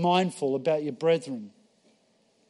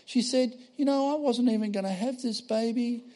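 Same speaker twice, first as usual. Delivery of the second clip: higher, moved, amazed voice of the weeping lady; tearful pregnant lady; 8 semitones higher than usual. Retold or imitated. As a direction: lightly voice the woman moved to tears. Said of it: "And she goes".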